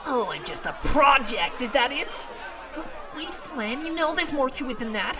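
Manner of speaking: high, loud wounded accusation; hurt angry outburst. Acted. Character accusing Flan, hurt and loud. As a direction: shout it, stung.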